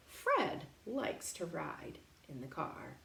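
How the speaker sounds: usual speaking voice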